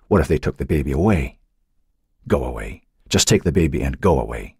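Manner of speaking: plain reading voice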